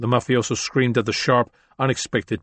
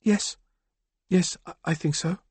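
higher, softer, hesitant woman's voice; shaken woman; 7 semitones higher, 3 dB quieter than usual. Acted. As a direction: answer unsure, still shaken. Shaken woman after violence.

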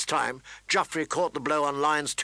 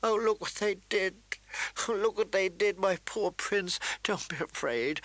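higher, tearful, tender lament; sobbing; 5 semitones higher than usual. Acted. Sansa crying over the wounded prince, high and tearful. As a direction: grieve over him through tears. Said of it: "she sobbed".

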